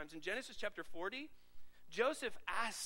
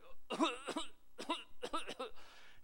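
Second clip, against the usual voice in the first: exaggerated hacking cough; fake sick cough; 5 semitones higher than usual. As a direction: ham up a sick coworker's cough. Mimicking sick coworker.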